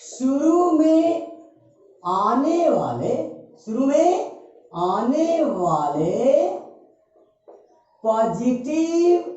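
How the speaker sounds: very drawn-out words, long pauses; slow dictation. Real, not acted. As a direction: dictate phrase by phrase for note-taking. Teacher dictating the fifth step.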